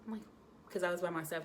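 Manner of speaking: ordinary talk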